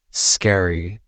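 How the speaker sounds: ordinary delivery, nothing marked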